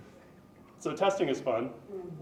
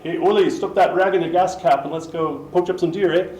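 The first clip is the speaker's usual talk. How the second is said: loud, higher-pitched Michigan accent; 5 semitones higher, 12 dB louder than usual. Shown as a demonstration. Lecturer showing off a regional accent, loud Michigan accent. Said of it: "talk like I'm in Michigan".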